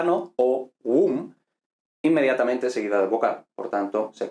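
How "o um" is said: "O, um" broken into two distinct syllables; split into syllables. Shown as a demonstration. Teacher showing the syllable start to students, split into syllables. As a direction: say "O", a short gap, then "um". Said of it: "está al principio de sílaba".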